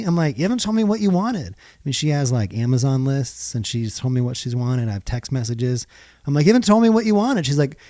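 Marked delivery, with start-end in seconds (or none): 0.4-1.5 fast, higher mock protest; defensive husband. Husband playfully protesting to his wife. Husband deflecting wife's complaint. 6.5-7.5 fast, higher, louder mock protest; defensive husband. Husband loudly repeating his excuse to his wife.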